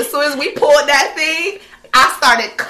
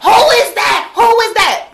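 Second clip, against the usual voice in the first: put-on drunk yelling; 7 semitones higher than usual. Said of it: "He like".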